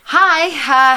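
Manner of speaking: bright forced-friendly hi; overly friendly. Retold or imitated. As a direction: sound too friendly on purpose. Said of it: "trying to be all friendly".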